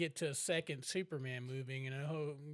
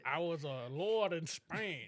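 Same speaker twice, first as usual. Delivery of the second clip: slow, drawn-out mock Scottish brogue as Connery's Spaniard; lazy faux-Scottish lord, slowed right down; Connery impression; slower than usual. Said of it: "making Connery a fucking Italian".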